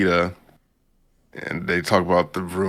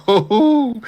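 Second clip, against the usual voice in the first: very high, loud hooting oh; excited oh; 9 dB louder than usual. Real, not acted. The recapper reacting to the burn with a loud high whoop.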